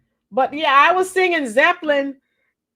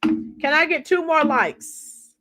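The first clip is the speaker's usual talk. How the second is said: ordinary delivery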